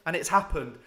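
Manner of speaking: normal talking voice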